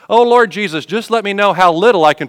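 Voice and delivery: high, loud mock-pleading call; put-on pleading appeal to the Lord; mock prayer. Believer bargaining over the offering plate, mock prayer.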